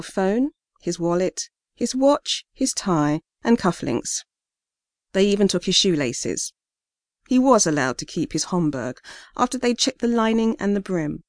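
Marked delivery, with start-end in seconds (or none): none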